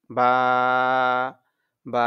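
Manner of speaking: বা with a long, held vowel; each বা held long and even; stretched vowel. Shown as a demonstration. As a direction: draw out the vowel of বা steadily. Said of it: "একটু টান হবে".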